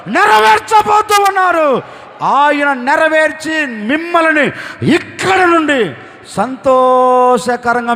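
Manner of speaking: rising, shouted blessing over the crowd; preaching at full force. Acted. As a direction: build the blessing to a loud climax. (ఉద్వేగంగా, గట్టిగా ప్రవచనం)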